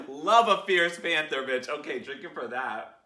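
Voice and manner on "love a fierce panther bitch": laughing delight. Real, not acted.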